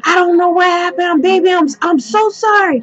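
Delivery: high, fast, begging apology; pleading boyfriend. Retold or imitated. Boyfriend begging his girlfriend for forgiveness, high and fast. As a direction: plead with her, words tumbling out.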